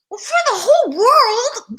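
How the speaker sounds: high, loud, wailing insistence; overexcited reply. Retold or imitated. Daughter answering her doubting mom, overexcited. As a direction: cry it out high and loud, carried away.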